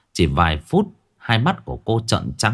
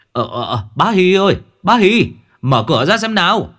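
calling out; 8 semitones higher, 4 dB louder than usual. Acted. Neighbour calling through the door.

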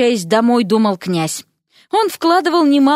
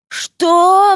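high, raised roar of disbelief; shocked roar; 9 semitones higher, 4 dB louder than usual. A narrator's reading. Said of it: "взревел Алёша". (взреветь от изумления)

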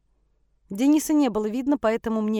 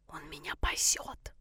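slow, breathy whisper into the phone; hushed secret whisper; 5 semitones lower, 10 dB quieter, slower than usual. A narrator's reading. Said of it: "прошептала в трубку". (шёпотом в трубку)

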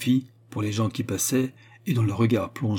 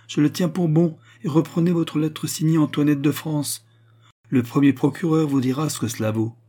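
raised, forceful royal voice; imperious dismissal; 6 semitones higher, 4 dB louder than usual. A narrator's reading. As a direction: lay down the law and send him off. (congé impérieux, voix haute)

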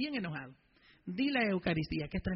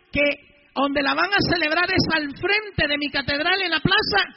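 louder, higher and slow, pressing the point; a retold priest's raised indignant voice; retold angry protest; 8 semitones higher, 10 dB louder, slower than usual. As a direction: insist hard on where it will be held. Priest retells confronting police captain.

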